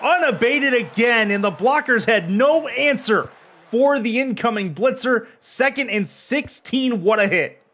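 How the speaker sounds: high-pitched, drawn-out big-hit call; excited play-by-play. Real, not acted. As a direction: call the blitz with soaring excitement. Calls a big sack live.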